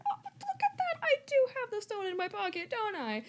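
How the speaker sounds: very high, sly fake surprise; high mock Harry. Harry feigns surprise at finding the stone. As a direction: squeak it up, acting surprised.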